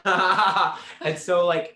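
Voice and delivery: laughing through a high, loud yeah; real laughter. Real, not acted. Answering the host's sorceress quip, laughing loudly. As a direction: burst out laughing at the joke.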